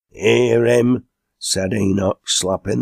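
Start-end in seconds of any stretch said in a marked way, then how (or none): 0.1-1.0 approving call. A narrator's reading. Table-slapping call of approval.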